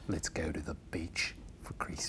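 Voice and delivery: put-on hushed, low Australian accent; barely-there Australian, near a whisper; quiet Australian accent. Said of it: "do my Australian accent".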